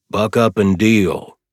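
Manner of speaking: slow, loud hearty push. A narrator's reading. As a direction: buck him up heartily. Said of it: "with a hearty".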